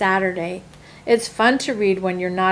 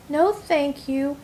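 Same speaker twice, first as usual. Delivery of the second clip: politely; 6 semitones higher, slower than usual. A narrator's reading. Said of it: "as politely as I can".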